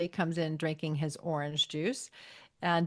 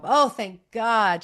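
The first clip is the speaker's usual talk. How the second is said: mock relief; 4 semitones higher, 8 dB louder, much slower than usual. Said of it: "she goes".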